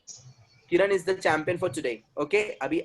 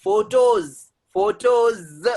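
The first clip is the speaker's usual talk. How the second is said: Photos loud and higher, ending on a clear z; teacher drilling photos, raised voice, drawn-out ending; emphasised final S; 5 semitones higher, 7 dB louder, much slower than usual. Shown as a demonstration. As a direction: hammer home the plural z in photos. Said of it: "एस को लाल भड़क किया हूँ मैंने".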